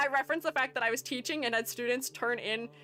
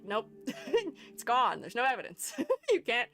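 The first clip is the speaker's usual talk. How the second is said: ordinary delivery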